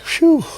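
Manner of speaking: high mock sigh of relief; relieved phew. Relief after deal accepted.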